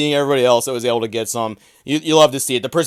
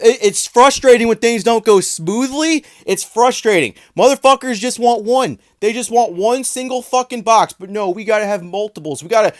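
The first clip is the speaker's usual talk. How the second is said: sarcastic mock sympathy; 7 semitones higher than usual. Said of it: "Oh, oh, you guys don't".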